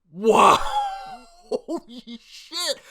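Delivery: loud shout breaking into high laughter; real shock and delight; laughing exclamation; amazed shout. Real, not acted. Amazed reaction to climax.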